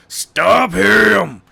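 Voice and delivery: loud, high "Stop him!"; Detective Daniels shouting into his radio; shouted order. Acted. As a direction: bark the order. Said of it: "shouted Daniels".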